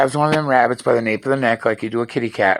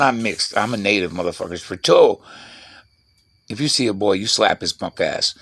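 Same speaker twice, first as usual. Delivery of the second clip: normal talking voice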